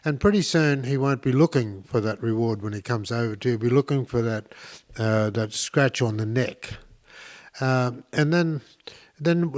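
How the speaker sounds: ordinary delivery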